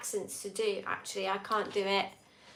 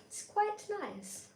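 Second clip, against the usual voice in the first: put-on Scottish accent; 9 semitones higher, 7 dB quieter than usual.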